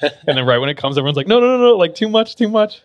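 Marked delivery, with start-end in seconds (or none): 1.3-2.8 high, loud frantic pleading; panicked protest. Retold or imitated. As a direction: beg it to stop in a panic. Townsfolk begging rain to stop.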